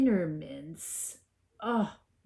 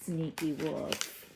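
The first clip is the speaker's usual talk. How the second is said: usual speaking voice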